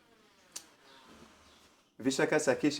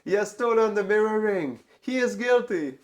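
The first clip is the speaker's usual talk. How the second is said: much higher and louder, the culprit found; triumphant accusation; 9 semitones higher, 5 dB louder than usual. Retold or imitated. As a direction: call it out to everyone, pointing at the thief. Sakhi exposes the hidden ring.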